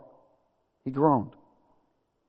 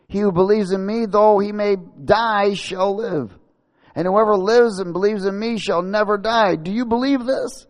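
raised, declaiming proclamation; emphatic Jesus; 8 semitones higher, 4 dB louder than usual. Acted. Jesus declaring himself to Martha, emphatic proclamation. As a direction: proclaim it high, ending on a challenge. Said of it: "And Jesus said".

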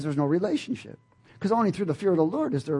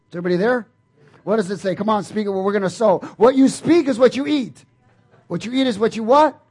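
loud, higher call and response; rally the room to answer back; preaching call-out; 6 semitones higher, 10 dB louder than usual. Acted. Preacher loudly calls the congregation to respond.

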